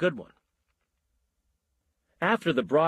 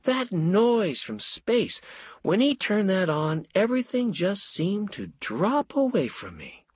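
dreamy; 8 semitones higher than usual. A narrator's reading. Wife in a dreamy trance.

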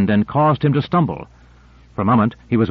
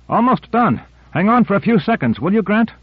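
raised voice for Owens, pitched higher; a lightly higher voice for Owens; Owens calling back; 10 semitones higher than usual. A narrator's reading. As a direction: call it back up from below, higher voice. Owens voiced higher than narrator.

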